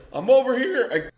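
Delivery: high, far-off call from the back of the room; guy calling from the back. Retold or imitated. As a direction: shout back from the crowd, a little lost. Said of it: "from the back room you hear".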